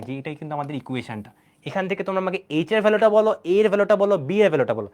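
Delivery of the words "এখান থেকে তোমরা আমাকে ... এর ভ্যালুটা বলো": loud, insistent teacher calling for answers; urgent demand. Real, not acted. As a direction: push the class loudly to answer.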